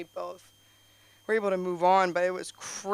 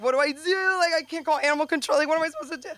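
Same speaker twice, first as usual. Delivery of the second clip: higher, fast, flustered rush of questions; panicked; 7 semitones higher, much faster than usual. Retold or imitated. Retelling her panic over the animal, high and rushed. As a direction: sound frantic and helpless.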